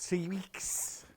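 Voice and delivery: a light Scouse lilt on three weeks; Liverpudlian accent. Retold or imitated. Fabric seller quipping to viewers in a Liverpudlian accent. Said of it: "in his best Liverpudlian accent".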